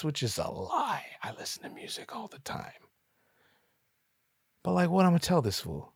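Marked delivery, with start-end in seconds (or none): 0.0-2.9 confiding whisper; whispered aside. Real, not acted. Narrator confessing to the listeners, whispered aside. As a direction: let the audience in on it, whispered.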